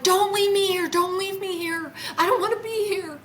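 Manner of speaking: very high, insistent retold plea; urgent begging. Repeating her plea to the craft seconds later, begging urgently. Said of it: "I said it again".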